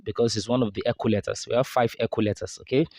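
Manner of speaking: ordinary talk, nothing marked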